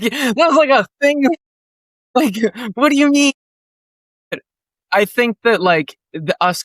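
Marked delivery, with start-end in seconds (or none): 0.0-3.3 baffled disbelief. Retold or imitated. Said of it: "I'm like".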